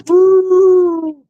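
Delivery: joyful shouted woo; loud high woo. Real, not acted. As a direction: let out a loud high whoop. Loud cheer.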